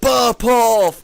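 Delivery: sudden loud high shout; yell. Retold or imitated. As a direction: yell it across the carriage. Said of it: "just yells".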